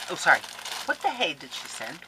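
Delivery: ordinary delivery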